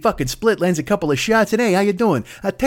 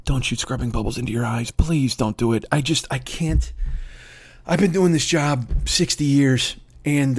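lower, soft pleading doctor; Fauci impression; 7 semitones lower, 4 dB quieter than usual. Fauci begging the public after Trump's mic drop, low and soft. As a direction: beg the public quietly, exhausted. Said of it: "Fauci scrambles to the microphone to go".

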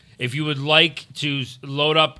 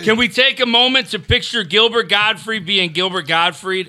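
slow, high, drawn-out shout-out; hype announcer read; 5 semitones higher, 4 dB louder than usual. Acted. Reads super chat in hype voice.

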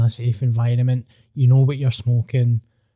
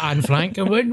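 ordinary talk

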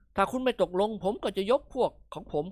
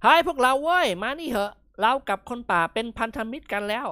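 calling out; 6 semitones higher, 5 dB louder than usual. Acted. Waving, calling his friends.

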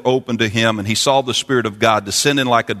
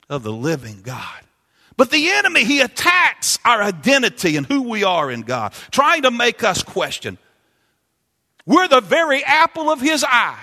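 shouting preacher; 10 semitones higher, slower than usual. Acted. Preacher shouting to the church that we're God's babies. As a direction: shout it out with joy.

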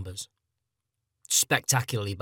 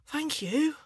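small, high-pitched thanks; grateful youngster. Acted. Young gamer thanks Japan for video games, high grateful voice. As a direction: say it tiny and high, like a grateful boy.

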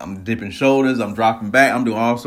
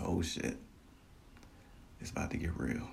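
very soft, under the breath; retold awe, nearly whispered; hushed dread; 2 semitones lower, 17 dB quieter than usual. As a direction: say it quietly to yourself. Retold dread as the rocket fires.

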